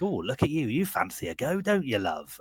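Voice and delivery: mock posh British barmaid, pitched high; high, put-on British accent teasing a customer; fake British accent. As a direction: ham up a fake British flirt. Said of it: "in a, like, fake British accent".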